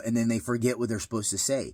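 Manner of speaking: normal talking voice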